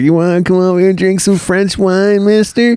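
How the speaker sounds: high, sing-song party invitation; inviting party voice. Retold or imitated. Locals inviting the British to a woods party, high and coaxing. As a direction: coax him over for French wine. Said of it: "They're just like".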